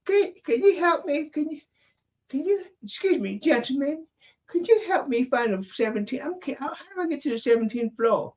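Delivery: put-on high falsetto little old lady, lost and polite. Old lady on the ship asking the men for help, high falsetto. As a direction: play a frail old lady asking strangers for directions. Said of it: "And she goes".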